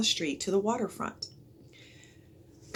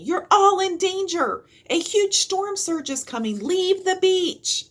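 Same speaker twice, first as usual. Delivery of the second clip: higher, louder urgent warning, last words slow; shouted warning; 13 semitones higher, 7 dB louder than usual. A narrator's reading. Annie warning sightseers of the storm surge, loud urgent shout. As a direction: drive the danger home to the crowd. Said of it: "shouted Annie".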